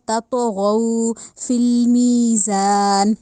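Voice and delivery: slow chanted تطغوا فی المیزان, heavy غ; tajweed recitation. Shown as a demonstration. Teacher recites the phrase as the correct model. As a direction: recite slowly with round heavy ghain.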